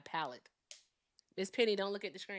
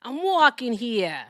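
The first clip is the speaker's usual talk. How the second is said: loud, higher, stretched Brooklyn shout; Brooklyn Italian; 4 semitones higher, 8 dB louder, slower than usual. Shown as a demonstration. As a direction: belt it out in hammed-up Brooklynese. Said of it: "I'm a Brooklyn Italian".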